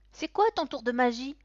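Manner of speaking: put-on high, loud eager child; eager boy. A narrator's reading. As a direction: ask like a curious kid. Boy eagerly asks clown. (enfant curieux, voix aiguë)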